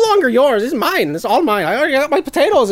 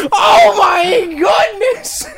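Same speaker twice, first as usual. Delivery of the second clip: loud, slow cry of shock; a hammed-up cry of shock; 5 semitones higher, 3 dB louder, much slower than usual. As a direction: howl at losing the arm. Arm shot off by potato.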